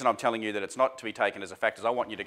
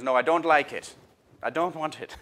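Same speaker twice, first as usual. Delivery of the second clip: a bit higher and louder, a lightly voiced whining kid; whiny child refusing; 5 semitones higher, 5 dB louder than usual. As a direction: push it away like a fussy little boy. Child refuses food again.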